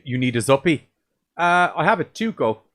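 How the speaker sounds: usual speaking voice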